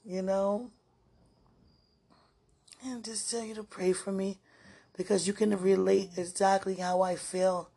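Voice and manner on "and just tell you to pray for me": hushed plea; whisper. Real, not acted. Whispering to sweetheart, asking him to pray. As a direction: whisper the request for prayer.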